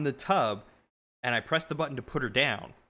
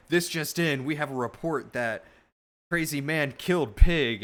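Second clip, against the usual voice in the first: lightly voiced news anchor, higher and louder on the last words; a hint of breaking-news reporter; mock news report; 3 semitones higher, 5 dB louder than usual. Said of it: "they're like".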